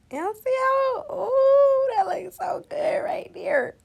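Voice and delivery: high, thrilled delight over the look; excited gushing. Real, not acted. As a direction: gush over it, voice way up. Admiring the brow excitedly.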